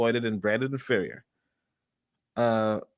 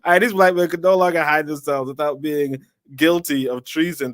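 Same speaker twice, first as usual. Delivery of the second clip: put-on lighter Jamaican accent, a bit higher than usual; toned-down Jamaican accent; 4 semitones higher, 4 dB louder than usual. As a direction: ease back to a milder Jamaican accent. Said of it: "let's just do a Jamaican accent".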